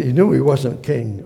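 ordinary delivery

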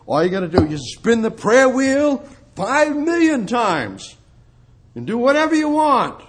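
high, mocking put-on voice; scornful parody. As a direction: sneer at empty ritual. Mocking prayer wheel religion.

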